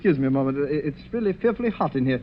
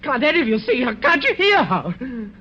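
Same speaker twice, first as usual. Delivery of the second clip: high, panicked cry, the second question drawn out slowly; begging the others to see what he sees; rising hysteria; terrified; 9 semitones higher than usual. Acted. Guest panics at the ghost.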